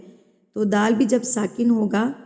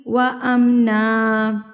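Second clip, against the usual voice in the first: slow tajweed chant; Quran recitation; 3 dB louder, much slower than usual. Shown as a demonstration. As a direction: recite slowly and melodically. (تلاوت کا انداز)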